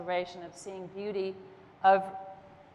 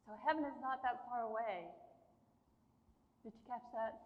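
choked up; 10 dB quieter than usual. Real, not acted. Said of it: "Sorry, I choked up".

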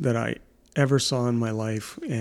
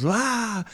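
jump scare shout; 9 semitones higher than usual. Retold or imitated. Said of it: "he jumps up all of a sudden and goes".

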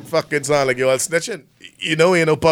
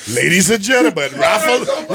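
raffle announcer. Acted. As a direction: call it out like a raffle draw.

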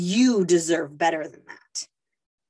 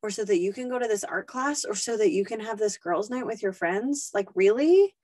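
scornful disbelief; 4 semitones higher than usual. Retold or imitated. Inner voice mocking a mom's date night, scornful disbelief. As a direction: ridicule her like a guilt-tripping voice. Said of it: "Those little voices will come to you and say".

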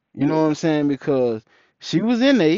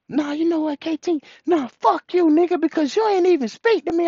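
very high-pitched mock accusation; imitated indignant woman; 13 semitones higher than usual. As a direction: mimic her telling him off, pitched way up.

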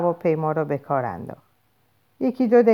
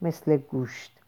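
quiet, distant inner voice; inner thought; 6 dB quieter than usual. A narrator's reading. Bernard thinking privately about Lenina, hushed inner voice.

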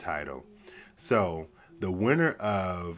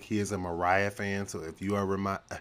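normal talking voice

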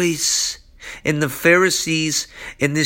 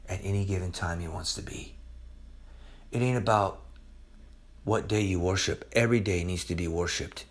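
very low, soft and solemn; hushed preaching; 10 semitones lower, 11 dB quieter than usual. Real, not acted. Preacher telling the congregation about daily worship, hushed and solemn. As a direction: drop right down to a grave hush.

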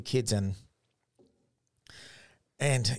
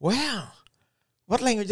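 put-on amazed kids; 11 semitones higher than usual.

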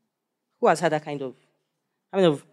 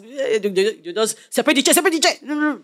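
mock frenzy; 6 semitones higher than usual. Mimicking frenzied worshipper.

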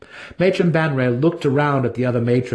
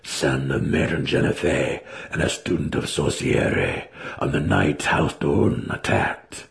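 lower, grave declaration; young drow male; 7 semitones lower than usual. A narrator's reading. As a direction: speak the lineage with pride. Alton declares lineage.

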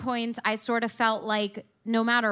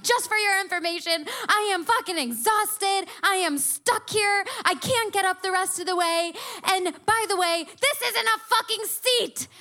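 higher, pushed, indignant complaint; exasperated rant; 8 semitones higher than usual. Retold or imitated. To her boyfriend at the top of the pyramid, an exasperated high rant. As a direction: let him have it, voice climbing with each line.

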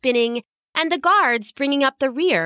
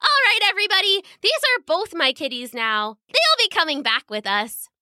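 put-on high girlish announcement, quick at first; bossy little princess; 7 semitones higher than usual. A narrator's reading. Princess turning to the guards following her, girlish command. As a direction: play a child proclaiming to grown-ups.

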